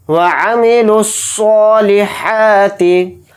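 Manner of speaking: slow, drawn-out tajweed chant; Quran recitation. Shown as a demonstration. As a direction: recite it slowly and melodically. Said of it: "वा थोड़ा खींच कर पढ़ेंगे".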